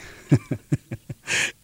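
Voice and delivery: breathy laugh. Real, not acted. Laughs at own naivety.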